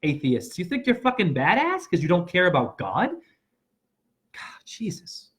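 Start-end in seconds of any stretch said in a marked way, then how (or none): 4.3-5.3 breathy whispered exasperation; whispered "God, Jesus" aside. Real, not acted. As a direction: mutter it under your breath.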